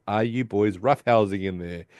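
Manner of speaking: usual speaking voice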